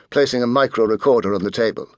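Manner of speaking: usual speaking voice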